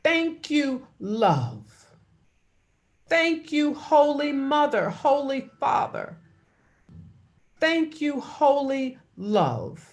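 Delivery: high, fervent prayer of thanks. Acted. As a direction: lift each thank you up in praise.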